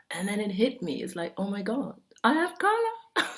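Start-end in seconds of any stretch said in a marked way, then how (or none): 1.4-3.3 mock-amazed realization, pitch jumping high; high-pitched, incredulous self-quote; acted astonishment. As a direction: act out a sudden startling discovery. Acts out sudden realization.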